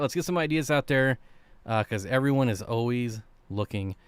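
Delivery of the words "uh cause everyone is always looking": ordinary talk, nothing marked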